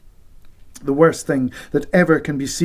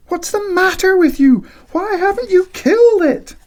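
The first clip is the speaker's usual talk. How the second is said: angry shout; 5 dB louder than usual. Acted. Wife scolds husband.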